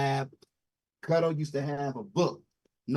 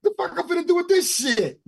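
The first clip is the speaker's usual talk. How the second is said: much higher, louder mock outburst; 12 semitones higher, 5 dB louder than usual. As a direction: go off in a high, scornful squeal. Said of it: "went off on him and said".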